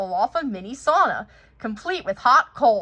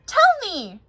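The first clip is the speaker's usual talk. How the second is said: eager demand; 10 semitones higher, 6 dB louder than usual. Acted. Olaf pleading eagerly.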